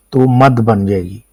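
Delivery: ordinary talk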